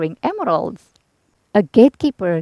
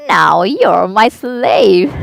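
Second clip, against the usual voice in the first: slow, louder gloating witch voice; wicked witch; 7 dB louder, much slower than usual. A narrator's reading. Witch gloats over her prisoners, slow and louder. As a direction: draw it out with evil glee. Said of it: "she cackled".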